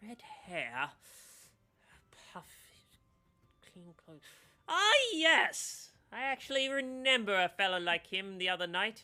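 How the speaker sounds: musing, then a high, loud eureka; old gnome recalling the stranger; pondering gnome. Acted. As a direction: think aloud, then remember brightly. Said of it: "he's like".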